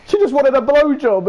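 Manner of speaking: high, whiny self-justification; defensive protest. Acted. Lad excusing himself over the upset girl, defensive protest. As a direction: plead innocence in a hammed-up high voice.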